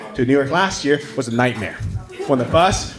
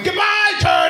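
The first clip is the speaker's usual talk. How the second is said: shouted farewell. Retold or imitated. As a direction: yell it after the sinking turtle.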